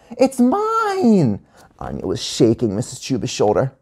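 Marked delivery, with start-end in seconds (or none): 0.1-1.4 put-on very high, drawn-out kid cry; high-pitched wail; insistent protest. A narrator's reading. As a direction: claim it like a possessive child. Anya insists, shaking teacher.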